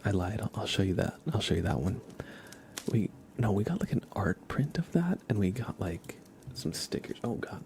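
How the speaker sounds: ordinary talk, nothing marked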